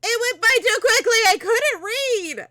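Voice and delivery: high, loud wail of protest; panicked yelling. Real, not acted. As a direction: yell it in dismay, dragging out the end. Dialogue vanished before reading it.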